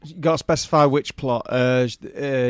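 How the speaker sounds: ordinary talk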